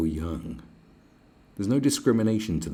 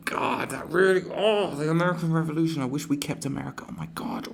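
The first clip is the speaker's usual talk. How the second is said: high, loud mock whine fading to a whisper; bitter British moaner; 11 semitones higher, 5 dB louder than usual. Mocking bitter Brits over the American Revolution, hammed-up whine. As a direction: ham up a sulky Brit who lost America. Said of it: "bitter British people ... and go".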